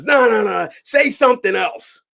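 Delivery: loud, high, slowed insistence; a director shouting down an actor's line, mock loud; director's mock shout. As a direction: bark the refusal like an impatient director.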